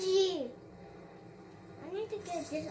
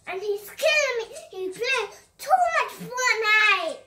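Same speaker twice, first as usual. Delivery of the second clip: higher and louder, fed up; loud exasperated rant; 4 semitones higher, 8 dB louder than usual. Real, not acted. Gamer rants about his bad teammate, loud and exasperated. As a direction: vent loudly about the teammate.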